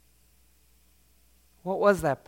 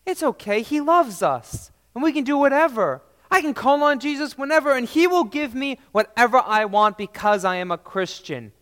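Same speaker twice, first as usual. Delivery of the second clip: higher, louder, quick mock of a careless believer; mocking false believer; 8 semitones higher, 6 dB louder than usual. Preacher mocks people who treat Jesus as a wish maker. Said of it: "That's wrong".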